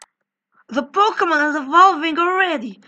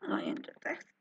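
hushed whisper to self; 17 dB quieter, faster than usual. Real, not acted. Player whispers to herself while typing a journal. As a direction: whisper it as you type.

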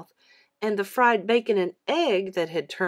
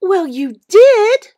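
high, loud, slow reminder; insistent little sister; 12 semitones higher, 16 dB louder, slower than usual. A narrator's reading. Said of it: "Mary reminded him".

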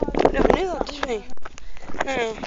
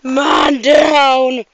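loud call of man down; man down shout; 8 dB louder than usual. Acted. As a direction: yell it like a soldier down. Calls out in game-like play.

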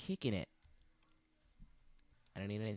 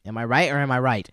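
louder, pushy rhetorical ask; emphatic question; 4 semitones higher, 15 dB louder than usual. Real, not acted. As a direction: demand agreement.

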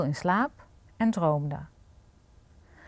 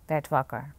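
ordinary delivery, nothing marked

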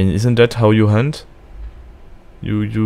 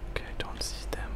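whispering; 17 dB quieter than usual. Real, not acted. Hiding, enemies searching.

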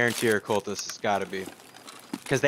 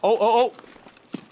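startled cry; 10 semitones higher, 6 dB louder, much slower than usual. Real, not acted. Sudden danger.

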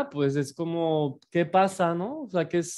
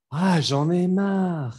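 slow, drawn-out sigh of "Ah, Jean Neymar."; fed-up intonation; 3 semitones higher, 4 dB louder, slower than usual. Shown as a demonstration. Teacher showing students the fed-up sigh. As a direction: groan "Ah, Jean Neymar." like you've had enough. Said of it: "Así con esa entonación".

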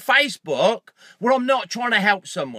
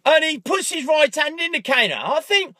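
ordinary talk, nothing marked

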